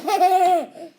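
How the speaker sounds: short bright burst of laughter; acted laugh. Reading to a child, a loud happy laugh. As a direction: laugh out loud at the goofy fish. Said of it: "He looks so goofy I have to laugh".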